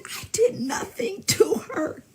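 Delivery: slow, very high put-on wail; mock tearful denial. Man insisting he did nothing to her, mock wailing.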